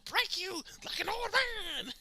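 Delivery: put-on "old man" stretched out high and slow; high, slow mocking quote; mock emphasis. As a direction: lean hard on "old man", slowly. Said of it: "why he gotta put emphasis on".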